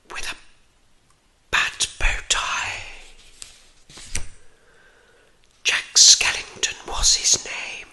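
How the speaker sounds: hushed, spooky bedtime-story whisper; breathy whispered narration for a spooky tale; whispered storytelling. A narrator's reading. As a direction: whisper the tale with long, suspenseful pauses.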